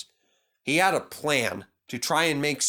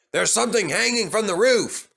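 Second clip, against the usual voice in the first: a loud call of warning up ahead; shouted; 7 semitones higher, 4 dB louder than usual. A narrator's reading. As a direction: shout it to the engine up front. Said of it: "shouted his driver".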